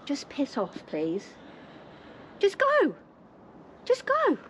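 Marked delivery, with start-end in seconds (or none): 2.4-2.9 high shout telling them to go; angry shout. Real, not acted. Ordering agents off property. 3.9-4.4 even higher pitched shout; angry shout. Real, not acted. Ordering agents off property.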